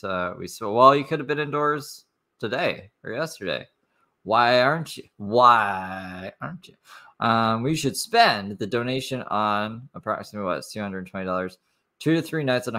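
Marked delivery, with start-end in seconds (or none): 5.2-6.8 slow, stretched, rising disbelief; drawn-out repeat. Real, not acted. Repeating the question to the vlogger, slow and incredulous. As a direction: drag it out in disbelief.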